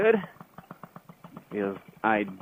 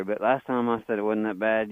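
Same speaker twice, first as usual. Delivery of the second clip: ordinary talk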